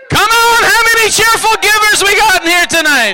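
high, full-voice rallying shout; rousing holler. Real, not acted. Preacher calling for cheerful givers, high rousing shout. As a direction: yell it to wake up the whole room. Asks again, shouting to crowd.

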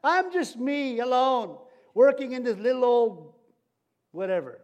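high, loud mock lament about being left alone; self-pitying whine. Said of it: "Everybody's talked this way".

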